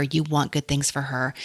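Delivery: ordinary delivery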